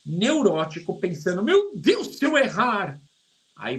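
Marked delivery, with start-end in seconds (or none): 1.4-3.0 high anxious cry, mocking a worried learner; a touch of mock alarm; mock panic. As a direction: play the neurotic student, mild fake panic. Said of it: "você vai ficar neurótica".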